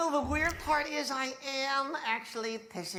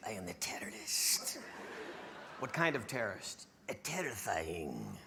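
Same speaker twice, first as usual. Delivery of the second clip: low menacing whisper; whispered menace; 10 dB quieter than usual. Pretend. Answering as the terrorist puppet, low whisper. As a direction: hiss it like a secret threat.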